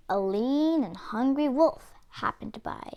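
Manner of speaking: plain reading voice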